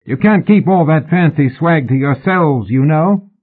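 high, hollered complaint; bellowed parting shot. A narrator's reading. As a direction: shout it after their backs. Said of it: "Mallory bellowed as a parting shot".

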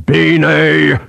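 ominous reprimand in one word. Acted. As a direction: cut him off with a stern call of the name. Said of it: "in his most ominous voice".

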